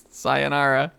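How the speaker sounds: normal talking voice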